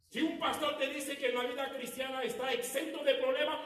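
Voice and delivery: loud, high, full-force preaching. Acted. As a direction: thunder it from the pulpit. (predicación a todo pulmón)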